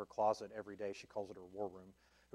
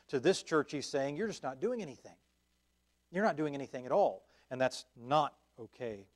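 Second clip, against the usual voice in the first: louder, slow, driving sermon voice that builds each phrase; fervent preaching; 3 semitones higher, 6 dB louder than usual. Acted. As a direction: preach it, pushing every line harder. Preaching at full force on works.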